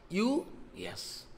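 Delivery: breathless whispered answer; a hushed, awed reply; whispered yes. Retold or imitated. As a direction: drop to a bare whisper. Rupa surprised, whispered reply.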